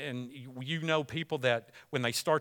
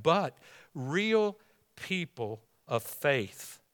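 "real people of faith" stretched slow, "real" leaned on; slow, weighty "real people of faith"; slow drawn-out phrase; much slower than usual. Acted. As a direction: linger over "real". Preacher restarts the phrase.

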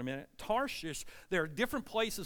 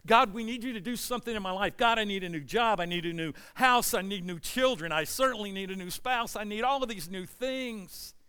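loud, raised, insistent mock prayer; demanding plea; 4 semitones higher, 6 dB louder than usual. Preacher imitating people who only pray in need, demanding. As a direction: pile up the demands, pleading and pressing.

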